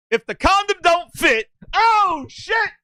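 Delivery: high, excited mock courtroom cry; hammed-up lawyer. Mister Cox comes out defending Booty, hammed-up lawyer. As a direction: belt it out like a showboating attorney.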